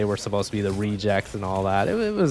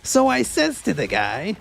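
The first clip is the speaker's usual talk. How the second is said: a much higher, slow mock New York Italian worker; a hammed-up Brooklyn builder telling a story; New York construction worker; 12 semitones higher, 3 dB louder, slower than usual. Streamer mocking what New York construction workers always say. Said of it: "construction workers always say the same thing".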